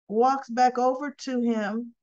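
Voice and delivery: ordinary talk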